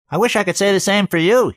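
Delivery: high, loud cartoonish teasing; old friend teasing through the door; comedy voice. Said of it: "the cartoon character he sounded like".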